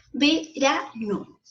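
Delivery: 'Verano' very slowly split as ve-ra-no; syllable by syllable. Shown as a demonstration. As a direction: break 'Verano' into three separate syllables. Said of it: "Ahí la tenemos separados en sílabas". ('Verano' dicho muy lento, sílaba por sílaba)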